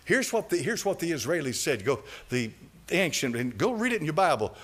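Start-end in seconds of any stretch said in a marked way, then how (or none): none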